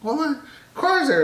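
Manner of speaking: high mock voice of the annoyed wife.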